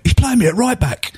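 high incredulous cry; he can't believe he's at right back. Retold or imitated. Footballer tells the fans, high and incredulous. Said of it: "completely incredulous".

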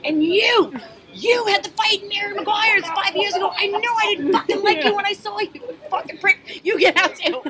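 high, loud angry tirade; furious shout. Retold or imitated. Bartender screams at the second troublemaker, high loud rage. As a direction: let him have it, full rage. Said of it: "And I'm like".

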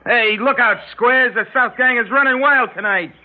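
hammed-up gang boast to the squares; mock gang boast. Pretend holdup, boasting to bar.

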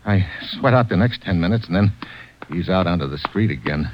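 low, even private-eye narration; hard-boiled narration. Acted. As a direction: tell it like a tired detective looking back. Hero narrates the noir story.

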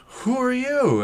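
very high-pitched retold alarm; mock startled woman. Host imitates terrified woman facing intruder, mock startled. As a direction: squeal the question in a put-on high voice. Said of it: "And she goes".

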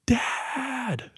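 high, held-back mock yell; imitated yell. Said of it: "And she yells".

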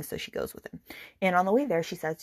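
usual speaking voice

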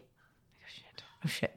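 hushed, breathless oh shit; a scared whisper to herself; whispered panic. Retold or imitated. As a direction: whisper it in dread as the phone rings. Panicked reaction to boss calling.

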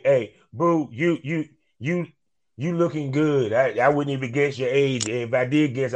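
usual speaking voice

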